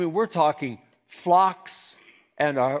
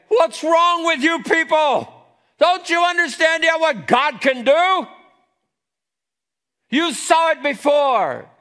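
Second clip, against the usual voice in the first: loud, high exasperated yell; frustrated shout; 11 dB louder than usual. Acted. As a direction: berate the people at full voice. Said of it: "Moses is frustrated".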